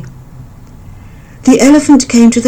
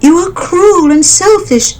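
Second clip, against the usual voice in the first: high, louder bird voice, furious; angry mother bird; 11 semitones higher, 4 dB louder than usual. A narrator's reading. Mother bird threatening the elephant, high and loud. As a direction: threaten the bully. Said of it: "she cried".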